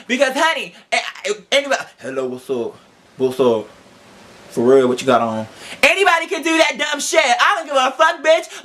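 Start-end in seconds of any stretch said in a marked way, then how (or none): 2.0-5.5 much lower, lazy mock pickup line; deep mock man voice. Mocking her date's phone voice, much lower and lazy. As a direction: drop low and play a smooth-talking date.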